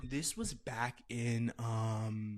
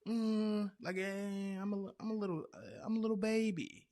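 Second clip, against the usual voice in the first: mock babyish whine; 8 semitones higher than usual. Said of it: "you're still kinda like".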